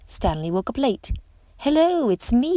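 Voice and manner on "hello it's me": high bright call to friends. A narrator's reading. Little fish calling out to his school, bright and high. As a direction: call out brightly across the reef. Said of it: "he called to his friends".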